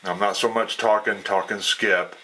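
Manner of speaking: ordinary talk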